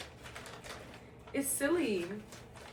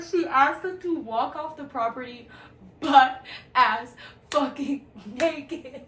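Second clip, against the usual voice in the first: slow words broken by laughter; laughing disbelief; 4 semitones higher, 7 dB louder, slower than usual. Real, not acted. Telling viewers about the naked walk, laughing loudly. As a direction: laugh through the outrage, drawn out. Outraged at being sent naked.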